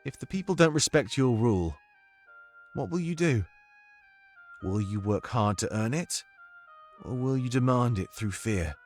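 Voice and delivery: put-on measured British accent, pausing between questions; Domik's comforting accent. Domik questions the monarch about ruling, careful British accent. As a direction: probe gently in a British accent. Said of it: "I need your comforting accent right now".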